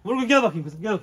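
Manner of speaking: loud, high call; calling the dog. Real, not acted. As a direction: call out to the dog across the yard. (köpeğe yüksek sesle seslenme)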